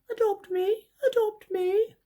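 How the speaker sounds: high, squeaky little dog begging; pleading puppy voice. Pretend. Seller voicing the dog figurine to buyers, high begging puppy voice. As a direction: voice the figurine as a tiny pup pleading to be taken home. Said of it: "he's looking at you saying".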